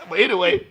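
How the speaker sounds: high laugh. Real, not acted. Laughing at own bit.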